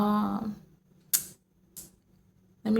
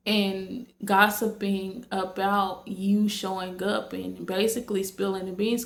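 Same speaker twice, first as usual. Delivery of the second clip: usual speaking voice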